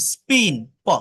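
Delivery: "স্পিন", then "প", both without a puff; non-aspirated p. Shown as a demonstration. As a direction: demonstrate a dry p in "স্পিন" and alone. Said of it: "non aspirated হয়".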